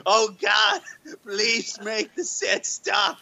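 mock anguished plea.

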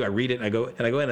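normal talking voice

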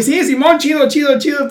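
high, loud nervous Simón chatter; hammed-up fake casual. Friend pretending to chat as drummer passes, high nervous babble. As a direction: blurt it like a nervous friend acting natural. Friend faking calm, red and sweaty.

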